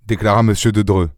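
usual speaking voice

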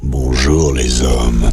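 low slow campy drag voice; Dubosc's deep woman voice. Acted. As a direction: drop low and drawl it. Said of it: "Oh, la voix de Dubosc".

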